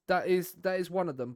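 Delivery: ordinary talk, nothing marked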